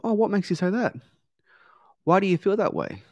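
high-pitched, interested follow-up question; curious probing. Acted. Coach asks client what's behind her answer, curious. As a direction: sound surprised and curious, gently digging.

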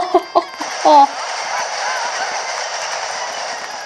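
long breathy laugh; real laugh, mostly air; laughter. Real, not acted. Laughs at how British it sounded.

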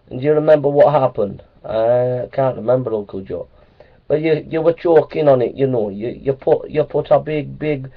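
a hint of Jamaican patois, chiding a nephew; Jamaican accent. Uncle chiding his nephew Jason, in a light Jamaican accent. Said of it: "Just doing my Jamaican accent".

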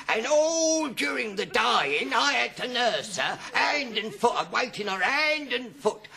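put-on high, slow, wailing old man; ranting old man. Old man in a chemist's to Stanley, ranting. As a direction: rant loudly, dragging out the grievance. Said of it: "this old man was ranting on".

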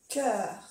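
"Cœur" con k y œ redondeada; pronunciación francesa. Shown as a demonstration. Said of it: "Es igual". ("Cœur" pronunciado a la francesa)

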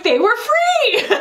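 slow, high, drawn-out deadpan; mock logic. Friend Hallie justifying the boots with girl math, drawn out. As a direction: savor the joke slowly. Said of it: "my friend Hallie was like".

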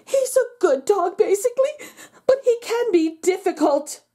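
a hint of the anxious owner defending her dog; fretful dog owner. A narrator's reading. Owner quoted in newspaper.

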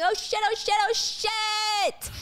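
high-pitched, drawn-out panicked yell; screaming. Retold or imitated. Man fleeing the plane, screaming. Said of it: "he's screaming".

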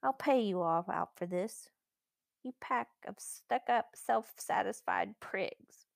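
slow, spiteful private threat; sulky boy's grudge, spaced out word by word; resentful, to himself. A narrator's reading. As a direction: draw out the insults as you vow revenge to yourself. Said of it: "Edmund was saying to himself".

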